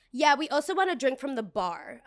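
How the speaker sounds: lightly put-on entitled voice; demanding customer. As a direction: imitate a pushy drink order. Imitates demanding customer ordering.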